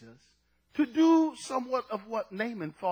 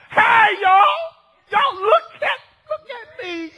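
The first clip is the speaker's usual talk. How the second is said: high, excited holler to a crowd; calling out; 12 semitones higher, 9 dB louder, slower than usual. Acted. Healed leper telling everybody, calling out. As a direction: yell for everyone's attention.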